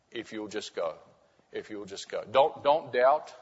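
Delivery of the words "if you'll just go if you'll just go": soft plea. Acted. Preacher pleading softly.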